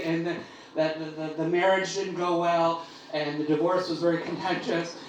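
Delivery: usual speaking voice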